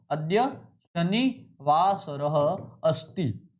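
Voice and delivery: अद्य शनिवासरः अस्ति spoken very slowly, each syllable drawn out; slow dictation. Shown as a demonstration. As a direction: dictate it slowly for students to write. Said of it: "ध्यान रखना".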